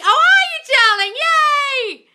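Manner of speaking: very high, loud gushing; thrilled for the viewer; squealed praise; delighted squeal. Real, not acted. Viewer says SP is back.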